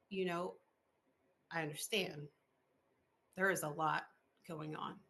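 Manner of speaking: calm her down kindly; a hint of a soothing, patient guide; calm reassurance. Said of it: "Spirit just very calmly said".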